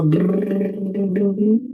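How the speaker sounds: lip trill sliding up; rising lip trill. Shown as a demonstration. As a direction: trill the lips, gliding upward. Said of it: "So I go".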